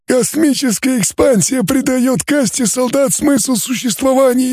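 high, strange alien timbre; a cool, detached alien scholar. Acted. Alien scientist continues. (высокий голос инопланетянина)

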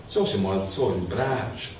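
usual speaking voice

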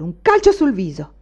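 grido forte e rabbioso. A narrator's reading. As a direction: rincara indignato, più forte. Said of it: "gridò Anania". (Anania grida contro il pretendente)

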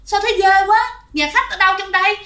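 high-pitched, hurried alarm; retold panic, pitch jumping up; panicked cry. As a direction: imitate a frightened wife crying out at her husband. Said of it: "tự nhiên bạn la lên".

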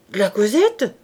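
startled, rising query; surprised question. Acted. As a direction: check eagerly whom he means. Innkeeper startled by the offer.

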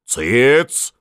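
sharp loud bark; old man silencing the room. A narrator's reading. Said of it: "рявкнул в угол старик". (рявкнуть)

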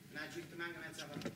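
normal talking voice

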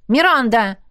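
short, cross correction; angry. Acted. Trainee correcting coach on her name, angry. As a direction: snap the name back crossly. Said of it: "рассердилась она".

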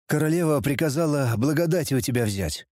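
usual speaking voice

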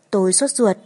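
usual speaking voice

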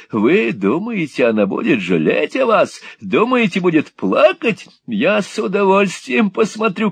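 high, loud mocking old devil; devilish glee. A narrator's reading. Gride mocking rejected suitor, devilish high glee. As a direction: gloat like a devil. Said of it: "превратился в сущего дьявола".

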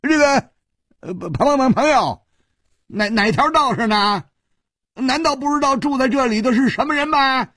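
a frightened man trying to sound bold; nervous bluster. Acted. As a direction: pump up courage, voice cracking high. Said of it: "大声说".

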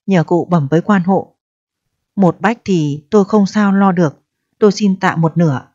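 plain reading voice

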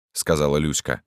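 ordinary delivery, nothing marked

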